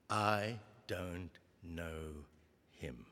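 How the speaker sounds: low, soft, dragged-out words of denial; quiet slow denial. Acted. Peter to the servant girl, slow hushed denial. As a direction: deny it under your breath, very slowly.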